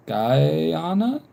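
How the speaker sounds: "Guyana" stretched out with a questioning rise; unsure pronunciation. Real, not acted. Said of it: "Am I saying it right".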